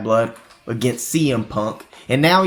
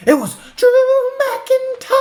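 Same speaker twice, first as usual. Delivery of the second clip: sung-out, soaring name call; hammed-up reveal of Drew McIntyre; announcer reveal; 3 dB louder than usual. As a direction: belt out the name like a ring announcer. Dramatic reveal of returning wrestler.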